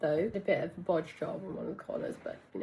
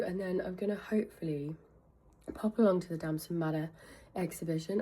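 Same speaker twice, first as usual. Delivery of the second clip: usual speaking voice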